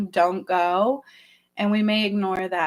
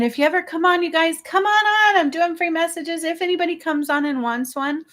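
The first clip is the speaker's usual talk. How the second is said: excited call to viewers; 7 semitones higher than usual. Real, not acted. Host calls viewers to join, excited and higher. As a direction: wave everyone in with a lift in the voice.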